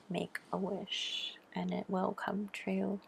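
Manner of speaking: slow, breathy secret whisper. Shown as a demonstration. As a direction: hush it like a wish you keep secret. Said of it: "So make a wish".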